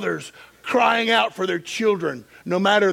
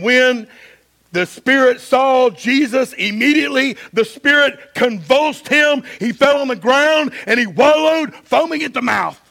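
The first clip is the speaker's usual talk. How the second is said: raised, driving sermon delivery; higher-pitched, emphatic pulpit reading; preaching; 6 semitones higher, 6 dB louder than usual. A narrator's reading. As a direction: read scripture with preaching force. Preaches at full force.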